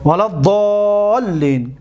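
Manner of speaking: slow drawn-out recitation of ولا الضالین with long doubled لّ; Quranic recitation. Shown as a demonstration. Teacher to students, recites the Fatiha ending slowly.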